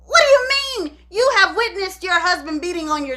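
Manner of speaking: high-pitched disbelief; real exasperated disbelief; incredulous. Real, not acted. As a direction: challenge it in high, indignant amazement. Narrator's own incredulous reply to mother.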